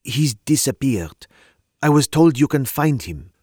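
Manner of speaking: a hint of a French woman's accent. A narrator's reading. Young French woman telling detectives of brother, light French accent. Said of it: "her accent she was from the continent".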